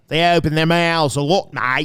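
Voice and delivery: broad, loud Australian impression with open mouth; Australian accent. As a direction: talk like an Aussie, mouth wide open. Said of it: "You have that down".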